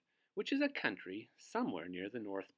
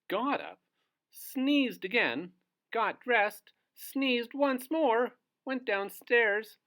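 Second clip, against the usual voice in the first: lively storytelling; 11 semitones higher, 7 dB louder, slower than usual. A narrator's reading. Lively read-aloud, sneezing story.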